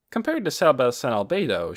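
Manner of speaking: ordinary delivery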